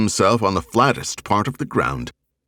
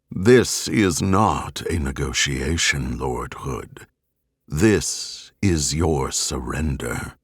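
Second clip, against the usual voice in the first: lower, slow, cold and unyielding; Cortana, steel in voice; 5 semitones lower, slower than usual. A narrator's reading. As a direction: deliver a calm ultimatum, voice of steel. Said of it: "with steel in her voice".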